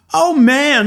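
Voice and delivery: high, loud startled "oh man"; startled cry. Acted. Aladdin startled by the school bells on his carpet, high loud cry. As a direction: jump at the sudden bell.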